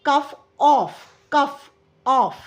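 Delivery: "कफ" said like "ऑफ", with a short open o; pronunciation demo. Shown as a demonstration. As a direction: repeat "कफ, ऑफ" slowly, matching the vowel. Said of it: "कॉफ पढ़ेंगे हम इसे". ("कफ" को "ऑफ" की तुक में, धीरे बोलें)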